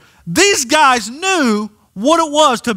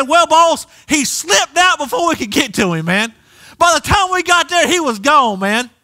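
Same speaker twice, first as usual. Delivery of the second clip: mock excuse; 9 semitones higher than usual. Pastor voices an imagined excuse.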